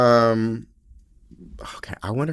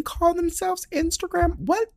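much higher, baffled disbelief; incredulous. Real, not acted. Mocking the term Instagram model, much higher incredulous question. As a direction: pitch shoots up in disbelief.

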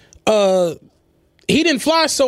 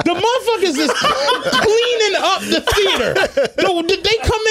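laughing while telling; 8 semitones higher than usual. Real, not acted. Laughing at the cleaners arriving.

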